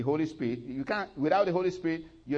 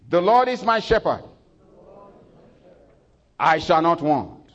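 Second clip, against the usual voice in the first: high, loud call-and-response line; leading congregation recital; 5 semitones higher, 10 dB louder than usual. Acted. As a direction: lead the church in saying it. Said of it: "I want you to say it with me".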